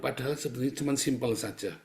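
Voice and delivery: normal talking voice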